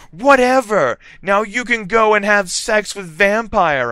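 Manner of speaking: high, loud angry shout; storming away from Draco. A narrator's reading. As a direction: hurl it at him. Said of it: "I shouted".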